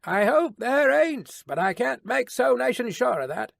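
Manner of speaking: high-pitched, mildly gruff grumble; gruff soliloquy. A narrator's reading. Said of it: "in a gruff soliloquy".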